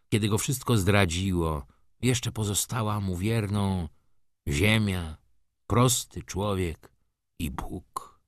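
ordinary delivery

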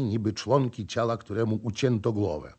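ordinary delivery, nothing marked

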